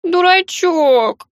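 high, loud, slow sob-laden word; sobbing tease. Acted. Girl to the boy, sobbing fondly. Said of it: "всхлипнула я".

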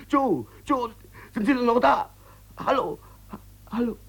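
cling to the dead line, near tears; desperate crying. Acted.

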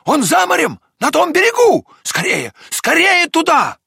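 high, loud, excited urging; dog's shout. A narrator's reading. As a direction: cry out urgently to get moving. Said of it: "кричала собака". (взволнованный высокий крик собаки)

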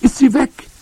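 put-on high, loud child's shout; boy yelling down, urgent; calling out. A narrator's reading. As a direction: cry out for grandmother. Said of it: "rief ich noch einmal".

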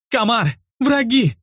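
high, slow, terrified cry of alarm; shrill panicked shriek. A narrator's reading. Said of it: "истошно заверещала".

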